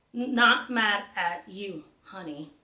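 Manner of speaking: slow, with gaps between each word of "Not mad at you, honey"; slow deliberate echo, each word set apart; spaced-out words. Acted. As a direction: leave a pause after every word. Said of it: "adding extra spaces between the words".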